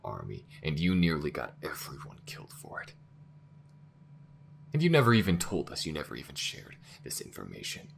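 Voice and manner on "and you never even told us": loud, high burst of betrayal; angry accusation. Acted. Crew member accusing the AI of secrecy, loud high anger. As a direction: hurl it at them, betrayed.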